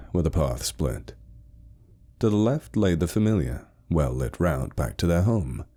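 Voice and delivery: usual speaking voice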